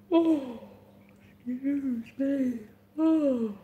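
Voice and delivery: drawn-out yawn through the words; slow yawning apology. Real, not acted. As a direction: stretch it out with a real yawn.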